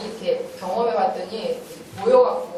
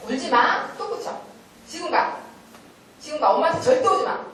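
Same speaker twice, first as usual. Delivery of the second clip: higher-pitched, firm commands; firm orders; 6 semitones higher than usual. Shown as a demonstration. Parent sending a crying child away from mum, firm orders.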